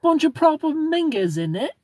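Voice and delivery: put-on very high, loud British accent; mock British king. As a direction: squawk it as a hammed-up British royal. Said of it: "The king said".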